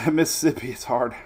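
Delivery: ordinary talk, nothing marked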